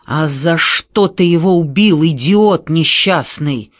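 loud, outraged acted tirade; enraged shout, slowing on the insult; furious yell. A narrator's reading. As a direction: berate him at full voice. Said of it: "заорал в бешенстве Шарунас".

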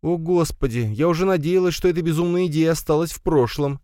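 high-pitched motherly grumble; displeased grumbling. A narrator's reading. Mother laments son's crazy idea, displeased grumbling. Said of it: "недовольно проворчала мать".

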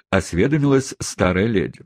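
plain reading voice

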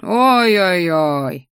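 slow drawn-out teasing groan; eye-rolling mock. A narrator's reading. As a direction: roll your eyes at him. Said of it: "закатил глаза мой ящер".

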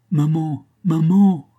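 appel d'enfant, aigu, de plus en plus fort; enfant malade enroué. A narrator's reading. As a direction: appeler sa mère en petite fille malade. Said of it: "disait d'une voix rauque". (voix d'enfant malade qui appelle)

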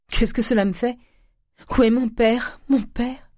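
high, loud, sobbing plea; sobbing cry. A narrator's reading. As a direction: beg for his father through tears. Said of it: "Les pleurs et les sanglots". (supplique aiguë en sanglots)

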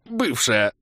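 forced out mid-cough; squeezed out through coughing. A narrator's reading. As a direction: choke the word out. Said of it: "с трудом выдавил". (выдавил сквозь кашель)

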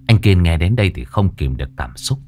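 ordinary delivery, nothing marked

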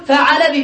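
فعل said fa-'a-la, middle vowel a; fatha on ain. Shown as a demonstration. Teacher listing verb patterns, فعل as fa'ala. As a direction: say فعل with an open a after the ain. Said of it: "بفتح العين".

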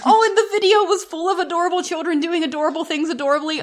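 high, fast, loud burst of adoration; gushing. Real, not acted. Co-host overwhelmed by video of children, gushing. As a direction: gush helplessly, words tumbling out high. Overcome by cuteness, then apologizes.

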